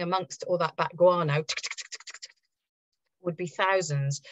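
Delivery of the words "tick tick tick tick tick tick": soft unvoiced ticking; clicking tick sounds. Shown as a demonstration. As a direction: tick tick like scuttling bugs. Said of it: "imitating scuttling cockroaches".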